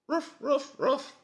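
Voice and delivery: high, playful little yaps from a hand puppet; puppy barks. Pretend. Voicing his hand as a little dog, high yapping barks. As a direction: yip like a tiny dog. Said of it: "here's my little doggy hand".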